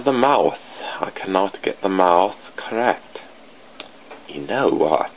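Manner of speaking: ordinary talk, nothing marked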